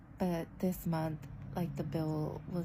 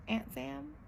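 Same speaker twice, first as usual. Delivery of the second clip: questioning echo; 7 semitones higher than usual. Real, not acted. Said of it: "Call me Aunt Sam".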